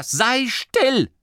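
high, angry outburst; trembling with anger. A narrator's reading. Masklin silences the bickering committee, trembling with anger. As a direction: cut them off in high-pitched fury. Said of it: "Er bebte vor Zorn".

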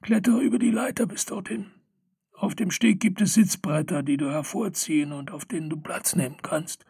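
high, quick gnome instructions; old gnome voice. Acted. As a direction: direct him like a busy old gnome. (hohe, eilige Gnomstimme)

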